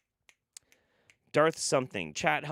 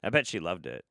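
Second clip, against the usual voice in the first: ordinary talk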